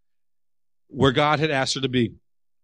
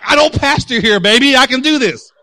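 loud, high-pitched retort; retold heated protest; fired-up protest; 9 semitones higher, 8 dB louder than usual. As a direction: brush off a calming hand, all worked up. Acts heated reply to wife.

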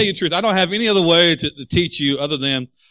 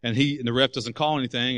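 usual speaking voice

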